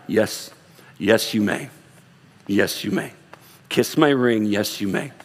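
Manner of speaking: lordly, low and slow, laughing at the end; mock regal permission. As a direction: play a smug king letting a subject kiss his ring. Mock lordly spouse granting permission.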